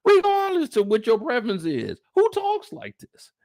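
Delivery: outraged at the text messages; incredulous. Retold or imitated. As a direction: stretch out who talks like this in disbelief. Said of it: "'cause I'm like".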